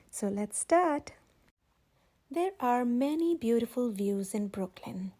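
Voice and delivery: plain reading voice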